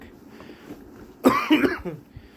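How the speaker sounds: high hacking cough; sharp cough. Real, not acted. Coughing mid explanation.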